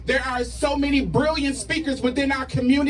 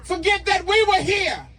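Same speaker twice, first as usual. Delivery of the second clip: rally speech; 3 semitones higher than usual. Acted. Spoken word rally poem, shouted.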